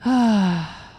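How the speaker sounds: loud, drawn-out sigh; heavy exhale, falling away; long sigh. Real, not acted. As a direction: let out a big audible sigh. Reacting to the hug scene.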